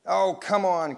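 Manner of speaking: mild, loud coaxing cry; a touch of boyish pleading. A narrator's reading. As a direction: coax loudly, pitch lifted. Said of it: "cried Larry".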